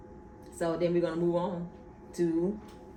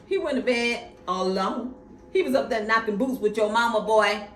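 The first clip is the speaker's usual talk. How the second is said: mocking; 5 semitones higher, 7 dB louder than usual. Real, not acted. Mocking Cole's excuse.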